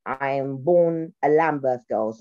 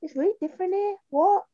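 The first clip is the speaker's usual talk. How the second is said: high-pitched Brummie imitation; much higher voice, Brummie lilt; Brummie accent. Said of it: "she had that Brummie accent".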